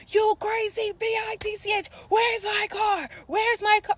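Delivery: high, loud mock yelling; imitating angry cussing. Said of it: "he cussing me out".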